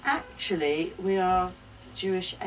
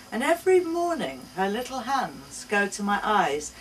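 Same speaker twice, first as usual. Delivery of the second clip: ordinary delivery, nothing marked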